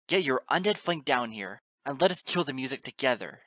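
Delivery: loud, furious ultimatum; sneering demand. A narrator's reading. Narrator demanding the goddess help finish the Nocturne, sneering angrily. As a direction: order them down at full voice. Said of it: "I hissed and sneered into the freezing gale".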